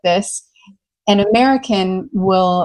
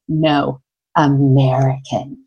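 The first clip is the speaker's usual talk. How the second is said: low, slow retold disdain; imitating a scornful verdict; deep disdain; 6 semitones lower, slower than usual. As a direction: say it with utter contempt, dragged out low. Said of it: "with the most deep disdain".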